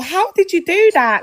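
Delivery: high mock astonishment; amazed co-worker imitation. Co-worker asking about her braids, mock amazed. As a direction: pitch it up, imitating an over-impressed colleague. Acting out co-workers' stupid questions.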